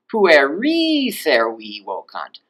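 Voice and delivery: slow Latin, "Pueri" swooped high on its long last syllable; high genitive first. Shown as a demonstration. Teacher showing genitive-first order, "Pueri" pitched high. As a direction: lift and stretch the end of "Pueri", then drop. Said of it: "it might be arranged like this".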